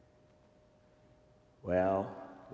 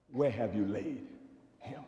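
hushed question; 7 dB quieter than usual. Shown as a demonstration. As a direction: ask it softly, almost to yourself. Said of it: "Ask the question".

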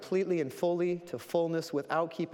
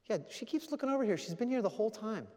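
quick, high-pitched retold reply; lightly acted reply; 9 semitones higher, faster than usual. Man pointing out the watching lady, lightly acted high voice. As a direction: voice someone else's answer, pitched up.

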